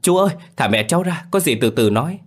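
high-pitched, urgent cry; acted shout. A narrator's reading. Child shouting at the man grabbing mother, high urgent cry. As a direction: call out urgently, pitched well above narration. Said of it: "liền hét lên".

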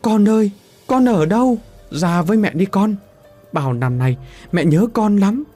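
put-on high wailing call for a lost child; crying out. A narrator's reading. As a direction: wail it out, voice high and loud. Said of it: "Cô khóc gào lên trong đau đớn". (người mẹ khóc gào gọi con)

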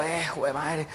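low, soft resigned curse; dismayed mutter. Acted. Owner sees the piglet back at the door, dismayed mutter. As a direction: curse under your breath.

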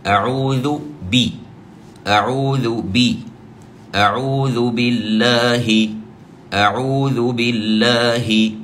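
أعوذ بالله drawn out with every vowel shown, the kasra of بي clear; slow, careful model recitation; slow, vowel-marked. Shown as a demonstration. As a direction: read أعوذ بالله slowly, sounding each vowel. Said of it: "فنقول مثلًا".

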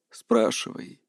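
put-on low, hushed creaky old seer; gracious creaky voice. A narrator's reading. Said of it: "милостиво кивнула она".

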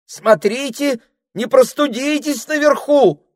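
high, loud fussing call; the snake fretting loudly at the parrot. Acted. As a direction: call up after him like a fussing nanny. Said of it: "крикнула Дульчебелла".

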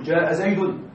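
زيد pronounced "Zaydun" with full case ending; teacher's model "Zaydun" on زيد; correct nominative ending. Shown as a demonstration. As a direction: end زيد on a clear "-un": Zaydun. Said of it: "نقول الفاعل مرفوع".

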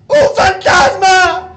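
slow, high, very loud shout; acted terrified scream. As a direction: scream it out as if seeing a ghost. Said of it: "Se pusieron a gritar".

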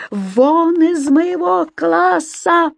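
high, very drawn-out angry bellow; yelling. A narrator's reading. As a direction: stretch every word in a furious yell. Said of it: "ещё громче завопил господин Стукин Крик".

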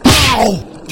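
high loud comic "ow"; mock yelp of pain. Reporter yelping as the news breaks again. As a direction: yelp as if struck.

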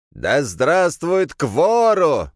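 high, drawn-out zealot's cry; fanatical shriek. A narrator's reading. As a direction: shriek it slowly like a crazed believer. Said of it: "заверещал худощавый мужик". (истошный, протяжный визг фанатика)

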